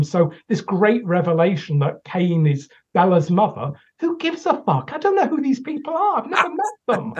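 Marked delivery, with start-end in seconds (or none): 4.0-7.1 high, fed-up exclamations; exasperated outburst. Real, not acted. Critic dismissing the characters to Joe, high and fed up. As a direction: throw up your hands in disbelief.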